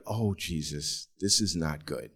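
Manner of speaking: low, hushed dismay; quiet dread. Retold or imitated. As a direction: say it softly to yourself, sinking. Acted dread on seeing the swelling.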